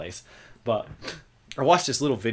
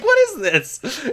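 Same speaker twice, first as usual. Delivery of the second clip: bemused mock question; 4 dB louder, much slower than usual. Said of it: "I was like".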